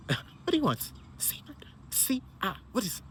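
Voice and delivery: whispery, laughing-edged query; mock-incredulous whisper; playful hushed question. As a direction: drop to a near whisper as if baffled. Playful questioning of craving wife.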